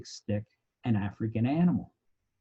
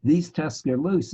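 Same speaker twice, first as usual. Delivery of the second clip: excited explaining; 5 semitones higher, 5 dB louder, slower than usual. Retold or imitated. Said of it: "And I'm going".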